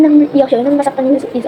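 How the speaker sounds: ordinary talk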